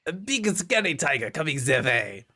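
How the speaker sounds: imitating a German accent, lightly; a hammed-up German accent on the th sounds; echoing the game character in a mock German accent. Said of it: "that's definitely German".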